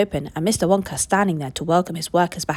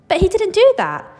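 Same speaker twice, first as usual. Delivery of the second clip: put-on high, louder child's protest; disbelieving protest; 11 semitones higher, 6 dB louder than usual. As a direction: insist he couldn't have. Charlie protests to Grandpa.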